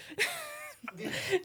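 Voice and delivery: squeaky laugh; high burst of laughter; high-pitched laugh. Real, not acted. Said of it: "I'm so not helping".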